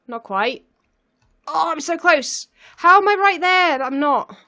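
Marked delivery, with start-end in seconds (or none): 1.5-4.4 loud, high, rising exasperation; nearly there and can't reach it; exasperated excited cry. Real, not acted. Nearly out of the maze.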